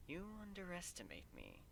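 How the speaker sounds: soft, hushed telepathic voice; voice in the mind. Acted. Celestia speaking inside the host's mind, hushed and resonant. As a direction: speak softly straight into her mind. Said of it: "Celestia's voice resonates in my ear and my mind".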